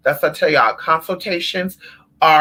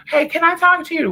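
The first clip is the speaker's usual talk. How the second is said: much higher fake-sweet ask; high sweet caller; 12 semitones higher than usual. Imitating caller.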